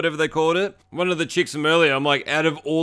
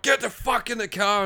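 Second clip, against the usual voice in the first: high mock-angry order; strained, raised-pitch retold command; going nuts; 7 semitones higher than usual. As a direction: imitate a man losing his temper. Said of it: "he's going nuts".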